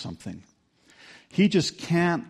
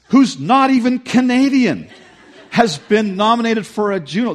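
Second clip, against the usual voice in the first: high, loud incredulous rant; hammed-up indignant roommate; mock outrage; 8 semitones higher, 7 dB louder than usual. Roommate interrupted at rehearsal, hammed-up outrage. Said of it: "And he's just mad".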